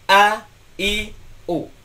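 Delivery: teacher models the hamza sounds for students; Arabic hamza vowels. Shown as a demonstration. Teacher demonstrating to students, crisp glottal onsets. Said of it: "Pertama adalah hamzah".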